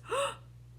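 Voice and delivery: sharp, high frightened gasp. Shown as a demonstration. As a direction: suck in air in shock. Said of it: "and you gasp".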